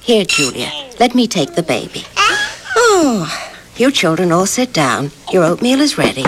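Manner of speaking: ordinary talk, nothing marked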